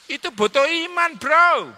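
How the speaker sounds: loud, high punchline; emphatic cry. Real, not acted. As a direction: hit the point hard and high. Preacher's emphatic point.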